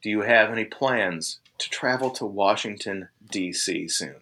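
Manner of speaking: low, soft, weary recitation; official questioning. Retold or imitated. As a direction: read out the form question, voice sinking. Marshal reciting form questions, acted.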